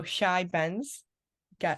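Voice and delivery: "Shy Ben's" drawn out at half speed; slow pronunciation demo. Shown as a demonstration. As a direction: say "Shy Ben's" slowly for a learner to copy. Teacher repeats phrase slowly for learner.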